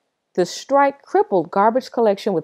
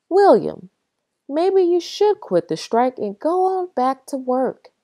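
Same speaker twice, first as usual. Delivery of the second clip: higher, begging wife; pleading; 8 semitones higher than usual. A narrator's reading. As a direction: gently plead with her husband. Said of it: "Mama pleaded".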